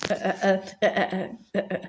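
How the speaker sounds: low, breathy mad cackle. A narrator's reading. Brother cackling at the broken pot, breathy cackle. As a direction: wheeze out a mad giggle. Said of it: "mad cackle of laughter".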